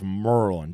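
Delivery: "Merlin" said low as "Mer-lun"; local pronunciation. Shown as a demonstration. Showing the chat how to say it: "Merlin" low, "Mer-lun". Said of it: "You gotta say it like that".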